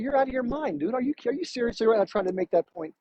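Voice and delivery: normal talking voice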